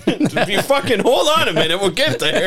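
laughing mock scold. Mock scolding own inner voices.

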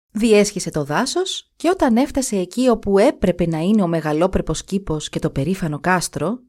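ordinary delivery

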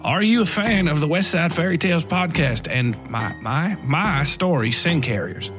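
ordinary delivery

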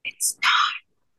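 whispered back; whispering. Real, not acted. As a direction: answer in a whisper. Whispered reply.

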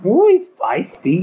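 put-on high, tickled Scottish old lady. An old centaur lady reacting to the pet, high Scottish coo. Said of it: "little sort of Scottish old lady".

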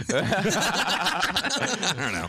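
normal talking voice

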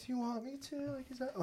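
very soft, high little voice; hushed, high-pitched pretend partner; imagined timid question. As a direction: ask it small and high, barely voiced.

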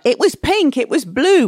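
a hint of a child digging in their heels; stubborn child insisting. An agitated child arguing about colours, mildly voiced insistence. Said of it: "putting it in a sort of way of like".

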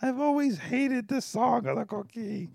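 imitating mom. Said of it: "she was like".

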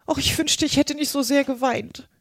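Alice wishing she hadn't cried; regretful little Alice. Acted. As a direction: lament it in a high character voice. Alice's voice in story.

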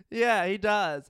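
ordinary delivery

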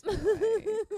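high, slow laughing aside. Real, not acted. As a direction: laugh it out to viewers.

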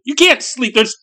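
high, loud, frantic plea; urgent high-pitched yelling to wake someone; shouted. A narrator's reading. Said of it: "he shouted".

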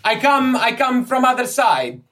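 put-on high, loud accented character voice; flustered, loud foreign scholar; excitable foreign Egyptologist, pleading. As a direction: insist eagerly in a high foreign voice. Plays Ippolito, the foreign Egyptologist.

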